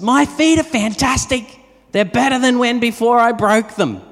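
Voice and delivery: high, loud joyful call over the hedge; calling out happily like the neighbour; mock yell. Neighbour yelling over the hedge to the pastor, high and joyful. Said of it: "yells out, she says".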